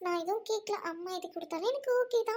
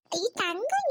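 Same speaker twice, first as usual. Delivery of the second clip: ordinary delivery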